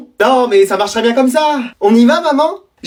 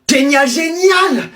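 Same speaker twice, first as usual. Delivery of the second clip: slow delighted génial; 4 semitones higher, much slower than usual. Acted. Pupil thrilled by principal.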